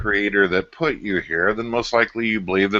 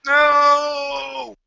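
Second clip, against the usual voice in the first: shouted no. Acted. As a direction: yell it in mock horror. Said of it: "mock horror at eating flesh".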